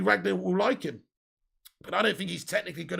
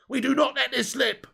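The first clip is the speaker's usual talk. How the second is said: higher-pitched Cockney impression of a rallying cry; Cockney accent; 6 semitones higher, 4 dB louder than usual. Streamer mimicking Gerrard's team talk, mistakenly in Cockney. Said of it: "said it in a Cockney accent".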